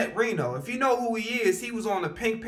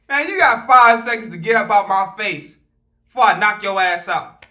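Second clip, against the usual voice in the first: louder, hard warning; threat; 8 dB louder than usual. Retold or imitated. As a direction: threaten him to back off. Threatening the customer.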